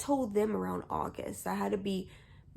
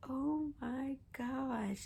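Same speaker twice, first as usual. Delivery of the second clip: slow, higher, soft disbelief; retold stunned amazement; 6 semitones higher, 5 dB quieter, much slower than usual. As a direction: draw it out softly in imitated shock. Retold dad stunned by the news.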